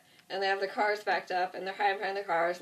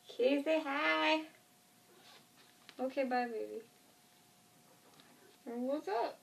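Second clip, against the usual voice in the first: high cooing pet voice; 6 semitones higher, 4 dB quieter than usual. Real, not acted. Greeting her cat mid-video, high cooing baby talk. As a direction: coo up high to the kitty.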